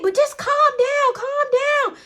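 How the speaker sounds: high, slow pleading calm down; pleading man. Retold or imitated. Alex trying to calm mom, high and slow. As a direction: beg her gently to calm down, pitched high. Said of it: "He's like".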